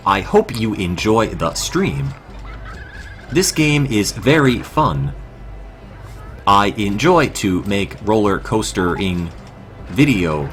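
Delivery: slow, stiff, monotone robot voice. Pretend. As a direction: speak like a stilted robot. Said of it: "That's my real voice".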